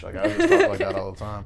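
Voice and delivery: high, laughing mock British; a playful stab at posh British; British accent. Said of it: "a really good British accent".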